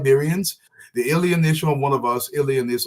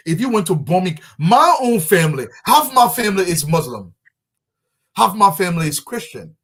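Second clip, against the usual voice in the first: impassioned emphasis; 5 semitones higher, 6 dB louder than usual. Real, not acted. Impassioned point about mixed family.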